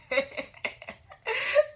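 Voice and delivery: high, loud burst of laughter; exasperated laugh. Real, not acted. Vlogger cracking up over being irritated, high laugh. Laughing at her own rant.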